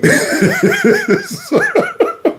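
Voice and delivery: long high-pitched laughing fit; giggling fit. Real, not acted. Host giving up on a last name, helpless giggling. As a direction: dissolve into real high giggles.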